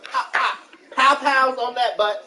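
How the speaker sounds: playful retold scolding. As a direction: act out a parent's telling-off, slowing on the punchline. Acts out spanking the child.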